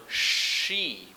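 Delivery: ordinary talk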